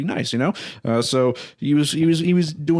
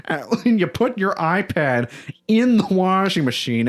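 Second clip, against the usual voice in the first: higher, drawn-out mock outcry; slow, high-pitched retold disbelief; mock scream; 6 semitones higher than usual. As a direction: imitate a partner's scandalized scream without full volume. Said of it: "just scream over the fact that".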